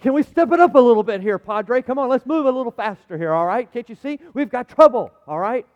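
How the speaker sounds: loud, impatient urging; screaming out. Retold or imitated. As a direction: hurry them along at a near shout, exasperated. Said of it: "I was screaming out".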